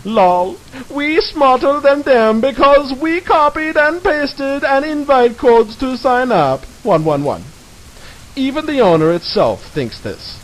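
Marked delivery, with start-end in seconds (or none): 0.1-7.4 put-on dumb forum kid voice; slow, high, smug and dim; hammy stage actor.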